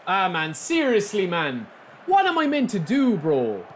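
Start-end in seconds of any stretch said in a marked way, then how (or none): 2.1-3.6 high, exasperated outburst at the lag. Real, not acted. Streamer fed up with his laggy opponent, exasperated cry. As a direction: throw the question up in frustration. Frustrated by laggy online match.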